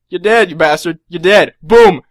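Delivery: loud, punchy play-acted kill; mock execution. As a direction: blurt it forcefully, cutting off all discussion. Acting out his quick kill.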